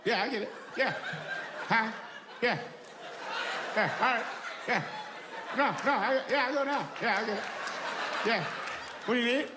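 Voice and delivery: nervous yes-man on the phone, laughing; mild browbeaten boyfriend voice. Acted. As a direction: agree with everything she says. Acted phone call with woman.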